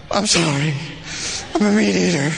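low, put-on sheepish confession; hammed-up apology; mock apology. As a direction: confess it with pretend shame. Mock apology to offended Krishna.

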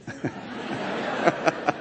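quiet real laugh between phrases; soft chuckle. Real, not acted. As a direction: chuckle softly to yourself. Amused at another scheme.

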